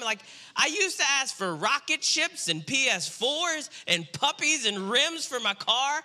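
ordinary talk, nothing marked

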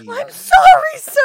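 high, loud panicked-sounding mock apology; re-enacted yelled plea; shouted apology. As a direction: blurt it out at the top of your voice. Calling out apology from hiding.

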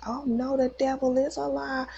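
high, fired-up retold declaration; fervent prayer partner. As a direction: imitate a church lady rebuking the devil. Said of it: "she was on it".

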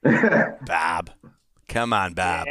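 low mock Massachusetts accent. Father chiding Barb, in a low Massachusetts accent. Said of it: "Massachusetts accent".

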